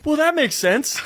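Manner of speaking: high-pitched, astonished concession; mock realization. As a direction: pipe up as if it suddenly adds up. Answers the talking bird in dream.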